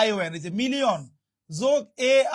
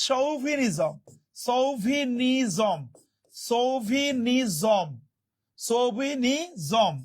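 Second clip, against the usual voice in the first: সৌভিনিজম slow and stretched, "sou-vi-ni-zom"; careful pronunciation model; much slower than usual. Shown as a demonstration. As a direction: draw out each syllable of সৌভিনিজম for learners. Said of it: "উচ্চারণটা খেয়াল করেন".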